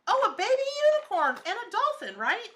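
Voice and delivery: high, loud burst of delight; excited discovery. Real, not acted. Vlogger spotting animals in the piece, excited. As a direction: exclaim it as you suddenly spot it.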